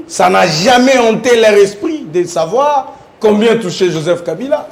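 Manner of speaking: ordinary talk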